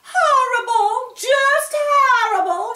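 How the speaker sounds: very high, loud slow wail; mayor's wife wailing over chipped paint, high and loud; crying. A narrator's reading. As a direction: wail it through tears. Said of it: "she cried".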